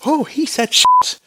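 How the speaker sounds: high, startled gasp of a line; mock shock. Watching children's TV, mock shocked surprise. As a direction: act scandalized at the cartoon hero. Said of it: "And I was like".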